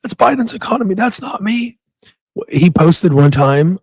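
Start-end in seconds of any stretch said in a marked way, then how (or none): 0.0-1.8 put-on higher old grandpa voice, fading softer; Trump impression. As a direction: whine it like a grumpy grandpa. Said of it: "sounds like an old grandpa".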